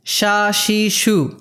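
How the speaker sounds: "সা, সি, সু" said sha, shi, shu; SH sound. Shown as a demonstration. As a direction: say "সা, সি, সু" with sh as in English "she". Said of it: "শিনের উচ্চারণ বাংলা তালব্য স্বর মতো". ("সা, সি, সু", বাংলা তালব্য শ-এর মতো)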